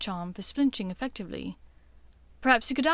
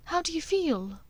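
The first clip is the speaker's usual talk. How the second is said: higher, caring question; 7 semitones higher than usual. Acted. Hermione asking the injured Weasley, higher and concerned.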